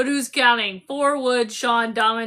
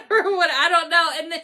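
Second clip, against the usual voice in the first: normal talking voice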